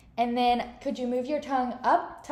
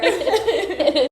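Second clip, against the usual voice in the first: laughing; 7 semitones higher, 10 dB louder than usual. Real, not acted. Examiner laughs at sour reaction.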